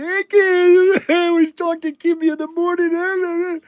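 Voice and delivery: high mock tearful voice; lightly sobbing son on the phone; imitating son crying. Son calling at night about his sister, mock crying. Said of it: "he's crying".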